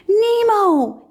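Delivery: high, loud anguished call; cried out. A narrator's reading. Marlin seeing Nemo floating, crying out loud and high. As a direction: cry his son's name in alarm. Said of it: "he cried".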